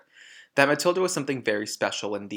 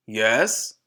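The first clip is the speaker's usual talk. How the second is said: loud, curt yes at the door; curt gruff yes; 6 dB louder than usual. Acted. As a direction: bark it like an annoyed man. Rude father opens door.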